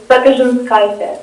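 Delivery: "Sapinskianti" said natively, with "zh" in the middle and "-kaite" ending; "Sapinskianti" said fluently in the Lithuanian way; Lithuanian surname pronunciation. Shown as a demonstration. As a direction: give "Sapinskianti" its Lithuanian sounds, ending KAI-teh. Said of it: "It's my Lithuanian last name".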